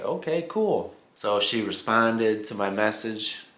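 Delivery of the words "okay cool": higher, lightly excited "okay, cool"; a casual, happy shrug of approval; pleased reaction. Retold or imitated. Retold reaction to same city.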